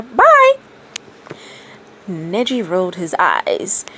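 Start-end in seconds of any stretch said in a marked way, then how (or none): 0.0-0.5 sharp squeak, much higher and louder; a tiny toad popping away with a shriek; shrill bye. A narrator's reading. The toad vanishes calling goodbye, shrill and very high. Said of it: "with a shrill".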